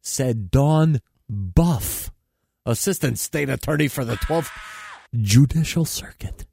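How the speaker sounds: high, punchy comedy newscast; mock news read. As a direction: deliver it like a satirical anchor. Reads mock news items.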